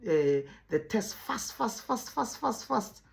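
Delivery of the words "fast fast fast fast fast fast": rapid-fire repeats, pitched higher; mock speed reading. Shown as a demonstration. Teacher mocking the wrong idea of fluency, rapid and high. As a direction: fire the word off over and over like a racing reader. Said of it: "people think the way you're able to read".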